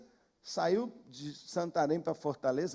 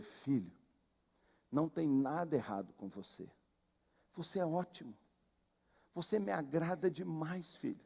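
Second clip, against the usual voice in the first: softer, gentle fatherly reassurance, only a little changed; lightly voiced tender God; 4 semitones lower, 7 dB quieter than usual. God answering the pastor hiding in the bush, softly reassuring. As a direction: comfort a discouraged son softly. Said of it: "E Deus falou assim com ele".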